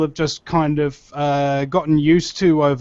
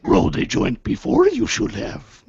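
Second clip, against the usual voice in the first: Yoda voice; 11 semitones higher than usual. Acted. Imitating Yoda.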